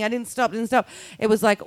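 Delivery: ordinary talk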